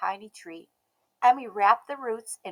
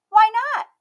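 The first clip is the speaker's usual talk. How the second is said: put-on high, bright little girl voice, loud and proud; a stubborn little girl declaring herself; Emmy's child voice; 13 semitones higher, 12 dB louder than usual. A narrator's reading. Emmy asking.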